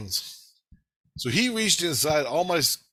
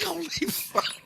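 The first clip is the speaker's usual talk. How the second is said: Tina's shock; 4 dB quieter, slower than usual. Retold or imitated. Tina's reaction voiced.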